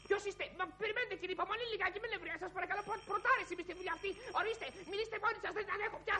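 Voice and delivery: frazzled clerk at the end of their rope; overwhelmed, exasperated. Acted. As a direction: lose patience and beg them to stop. Flustered clerk overwhelmed by angry callers.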